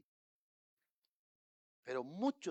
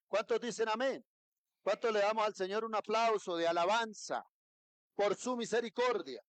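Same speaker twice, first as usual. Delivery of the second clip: higher, louder preacher cry; full-force preaching; 6 semitones higher, 6 dB louder than usual. Acted. Pastor stirring the congregation, preaching loud and high. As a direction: lift the congregation, voice ringing.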